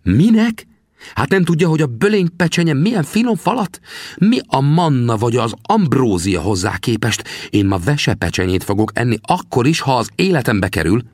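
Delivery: can't believe the question; indignant echo. Acted. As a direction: Sam is outraged at "Minek?". Sam echoes indignantly.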